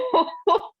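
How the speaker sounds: sudden high-pitched laugh. Real, not acted. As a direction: bursts out laughing at the stress. Laugh after stressful.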